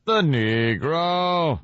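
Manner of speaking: slow, stretched vowels with a falling end; mock professor. Co-host parroting the professor from the clip, mock drawn out. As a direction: drag it out like an old film lecturer.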